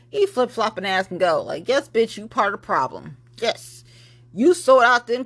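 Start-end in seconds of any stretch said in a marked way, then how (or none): none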